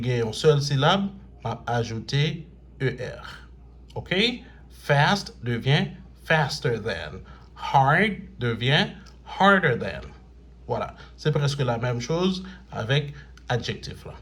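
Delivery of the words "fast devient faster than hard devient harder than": slow dictation. Shown as a demonstration. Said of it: "teacher dictating rule slowly".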